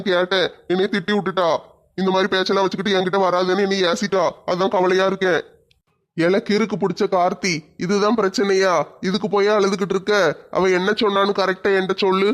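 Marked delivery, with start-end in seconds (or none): none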